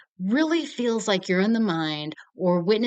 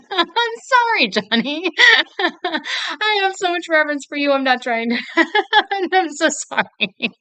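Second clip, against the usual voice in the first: laughing apology; 10 semitones higher, 5 dB louder than usual. Real, not acted. Giggling apology to Johnny Knoxville.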